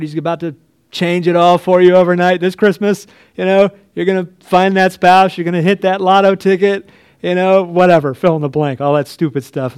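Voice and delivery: mocking prosperity preacher. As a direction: parody a prosperity preacher's overnight promises.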